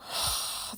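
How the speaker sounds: long breathy sigh, voiceless; exasperated sigh. Real, not acted. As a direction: let out a weary sigh after reading. Exasperated after reading mother's letter.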